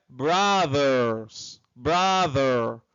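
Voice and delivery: "brother" said slowly with an open "uh" vowel; careful demonstration of "brother"; model pronunciation. Shown as a demonstration. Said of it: "convencionou junto com a sua comunidade que o som".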